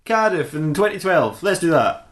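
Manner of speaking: high-pitched, lilting attempt at a Welsh accent; badly faked Welsh, pitched well up. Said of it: "That was a bad Welsh impression".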